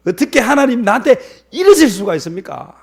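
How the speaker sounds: loud, high-pitched protest; indignant reproach. Acted. Jonah asks God how He could do this, indignant. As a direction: throw it at God as an outraged accusation. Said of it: "하나님 앞에 원망을 하니까".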